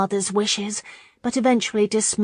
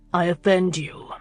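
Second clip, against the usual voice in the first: indifferent. A narrator's reading. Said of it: "he observed indifferently".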